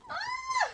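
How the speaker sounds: sudden high squeal of delight. Real, not acted. Vlogger squealing in delight at the special edition. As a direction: shriek with joy at first sight.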